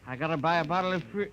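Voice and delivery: put-on fast, excited drunk rushing the words; eager drunken babble. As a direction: blurt it out fast before you forget. Drunk excitedly repeating product name.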